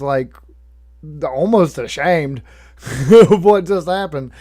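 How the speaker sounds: usual speaking voice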